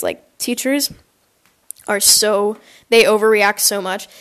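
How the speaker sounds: ordinary delivery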